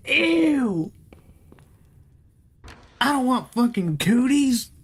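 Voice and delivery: put-on high squealing kid voice; childish disgust. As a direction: whine like a grossed-out kid.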